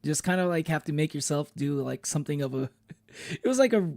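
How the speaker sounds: ordinary talk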